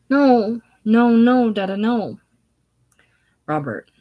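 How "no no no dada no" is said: high, loud tearful pleading. Acted. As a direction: beg like a child about to cry. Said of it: "Mary, tearfully".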